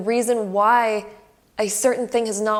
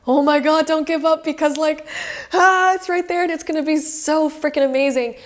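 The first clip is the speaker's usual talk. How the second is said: high, loud, thrilled urging; excited Spirit; 7 semitones higher, 5 dB louder than usual. Retold or imitated. Spirit cheering the querent on, high and loud. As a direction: burst with eager excitement. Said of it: "and they're like".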